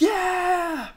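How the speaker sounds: high, far-off crowd yelling yeah; cheering crowd. Retold or imitated. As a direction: cheer like a whole crowd going wild.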